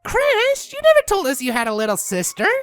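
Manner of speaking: high, fast excited exclamation; thrilled, rushing and high. A narrator's reading. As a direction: burst out in high-pitched amazement. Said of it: "examining the foal excitedly".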